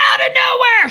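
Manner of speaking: abrupt, very high, loud screech; sudden scream. Retold or imitated. Showing how the sweet lady erupts out of nowhere, screamed. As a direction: explode into a scream without warning. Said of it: "she would start screaming".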